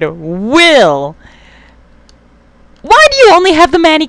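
high, loud exasperated disbelief; shocked outrage. Real, not acted. Player scolding his unit for bad weapon, high exasperated shock.

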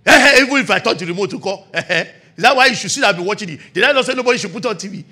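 loud, fast blustering scold; domineering parent. Retold or imitated. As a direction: bluster to avoid apologising. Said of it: "Very domineering".

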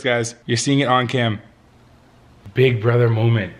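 ordinary delivery

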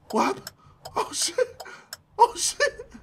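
high squeaky laughing whine; laughing disbelief. Real, not acted. Gamer cracking up at the broken game. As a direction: squeal it through helpless laughter.

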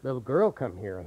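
the scary way not to do it; scary low voice. Shown as a demonstration. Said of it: "Don't say".

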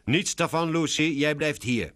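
high, firm order; commanding. A narrator's reading. Laura stops Lucy wandering off, commanding. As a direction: forbid it like a mother in charge. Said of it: "beveelt Laura".

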